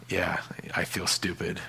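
embarrassed whisper aside; sheepish whisper to yourself. Pretend. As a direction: breathe it sheepishly under your breath. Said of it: "You'd be like".

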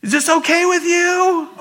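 high, loud holler down the line; calling out. Acted. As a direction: shout to someone far back. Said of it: "He goes".

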